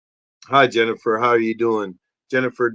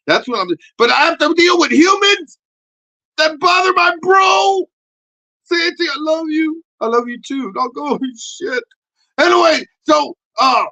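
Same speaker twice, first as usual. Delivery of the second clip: high, loud puppet shouting a defiant rant; 14 semitones higher, 7 dB louder than usual. Acted. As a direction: rant as the cocky pep puppet. Puppet voice again.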